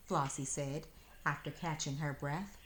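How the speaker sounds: usual speaking voice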